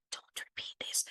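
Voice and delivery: hushed mock-secret aside; joking whisper. Shown as a demonstration. Teacher showing how to slip students a joke, whispered. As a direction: whisper it like a playful confidence. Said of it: "to put as a joke".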